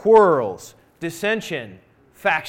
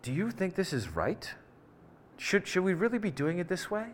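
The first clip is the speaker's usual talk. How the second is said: hushed, conspiratorial question, much softer; mock whisper; 9 dB quieter than usual. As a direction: lean in and quietly sow doubt. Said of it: "whispers in my ear".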